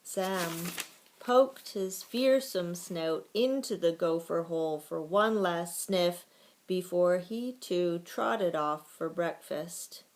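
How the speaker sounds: ordinary delivery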